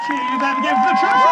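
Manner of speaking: very high, fast, loud rally; rousing cheer call. Real, not acted. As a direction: whip up the crowd. Whips crowd into cheer.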